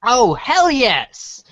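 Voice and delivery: normal talking voice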